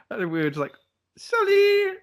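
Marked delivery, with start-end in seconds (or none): 1.2-2.0 very high, loud, drawn-out squeal; squealed mock apology. Caught lads apologise to bouncer before fleeing, squealed apology. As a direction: squeak it out as a comic panicked apology.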